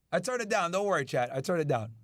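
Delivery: ordinary talk, nothing marked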